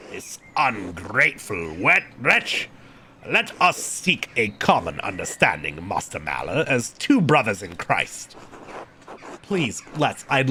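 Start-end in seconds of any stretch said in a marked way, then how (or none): 0.1-8.4 slow, lowered monk's voice; measured, deep churchman; exasperated prior. Acted. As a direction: exasperation reined into formal courtesy.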